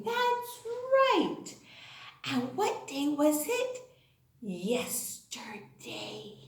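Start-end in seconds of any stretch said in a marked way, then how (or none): 4.4-6.4 breathy slow whisper. Acted. Teacher whispers the answer cue to her class, hushed. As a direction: whisper it like a secret.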